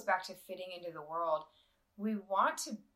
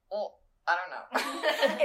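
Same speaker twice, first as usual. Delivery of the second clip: sheepish laughing admission; 7 dB louder, much slower than usual. Retold or imitated. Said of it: "And I was like".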